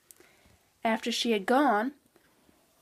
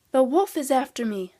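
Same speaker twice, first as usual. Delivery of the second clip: loud cry for help; frightened child calling out, acted; 3 semitones higher, 9 dB louder than usual. A narrator's reading. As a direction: cry out in alarm, much louder.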